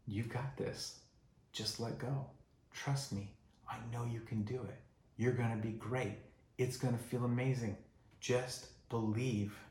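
soft, hushed comforting voice; calm a scared child softly; gentle reassurance. Retold or imitated. God reassuring the frightened believer, soft and tender.

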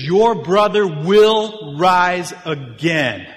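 slow, loud, high declaration; loud scripture quote, drawn out; booming proclamation. Retold or imitated. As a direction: proclaim it grandly, stretching every word. Quoting Jesus in dramatic retelling.

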